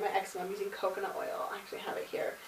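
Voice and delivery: ordinary delivery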